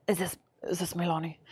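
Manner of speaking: low, soft Russian stranger on the line; hushed mock Russian accent; thick Russian accent. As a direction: quietly imitate a Russian caller. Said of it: "this thick, like, Russian accent".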